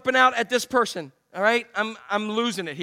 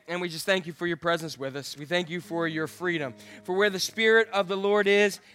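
prayer; 4 semitones lower than usual. Real, not acted. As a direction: lead the church in earnest prayer. Leads closing prayer.

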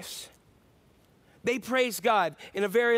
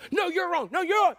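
higher, louder insisting; heated argument; 7 semitones higher, 4 dB louder than usual. Retold or imitated. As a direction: argue back hard. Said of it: "people getting heated".

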